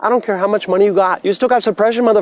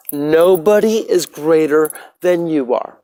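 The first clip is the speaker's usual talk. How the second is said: slow, settled repeat; slower than usual. Real, not acted. Telling viewers nobody is above them, slow and settled. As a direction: let it sink in slowly.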